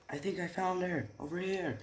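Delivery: voiced as a high call to others. Searcher to his party of ten, calling out.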